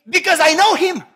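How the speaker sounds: loud, high declaration; defiant shout. Acted. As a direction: shout back your faith. Believer's loud answer of faith.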